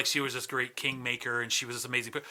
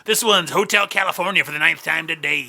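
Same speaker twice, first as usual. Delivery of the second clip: loud, higher radio DJ patter; the Gator; 3 semitones higher, 8 dB louder than usual. Pretend. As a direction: pitch the playlist like a hammed-up rock-station jock. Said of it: "called the Gator on".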